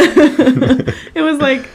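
slow high laughing; stretched out through laughter. Real, not acted. Laughing exasperation.

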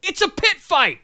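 very high strained outcry; exasperated yelling. Real, not acted. Co-host ranting about the fight rules, yelling. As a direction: protest at the absurdity, way up in pitch. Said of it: "I'm yelling a lot tonight".

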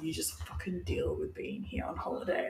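deep, quiet mock-stern mum voice; mom scolding. Mother telling her crying kid to cope, in a low hushed stern voice. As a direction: drop low and hush it like a fed-up mother.

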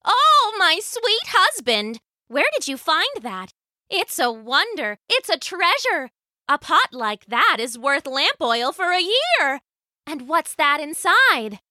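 high-pitched, marveling wife's voice; excited character voice, pitched well up; delighted old wife. Acted. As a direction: gush over a wonderful find.

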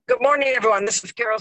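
ordinary talk

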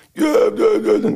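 high-pitched mock crowd yelling; high, excited cries mimicking a crowd; imitated crowd shouting. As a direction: imitate distant shouts without really shouting. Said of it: "heel veel geschreeuw van achter ons".

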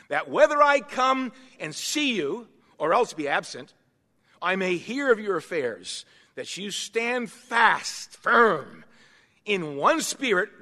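measured, emphatic verse reading, easing down at the end; scripture reading. Acted. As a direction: proclaim each phrase with weight. Reads the Bible verse aloud.

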